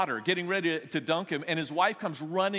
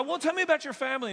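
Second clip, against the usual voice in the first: much higher and fast, over-eager pastor; eager greeting; 12 semitones higher, 5 dB louder, faster than usual. Retold or imitated. To the first-time guest, a high fast eager greeting. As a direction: chirp the question like a keen greeter.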